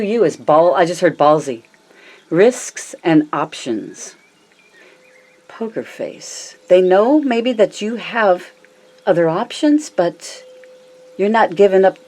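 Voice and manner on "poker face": breathy, slow whisper of the card name; whispered poker face. Acted. Tarot reader whispering the card name to the viewer, hushed. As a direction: hush it like a secret.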